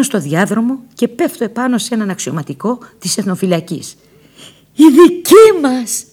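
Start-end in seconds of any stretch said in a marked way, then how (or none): 4.8-6.0 high, loud, slow cry of joy; shout of joy. Retold or imitated. Nina greets the officer, shouting slowly with joy. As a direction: cry it out beside herself with joy. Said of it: "άρχισα να φωνάζω έξαλλη από χαρά".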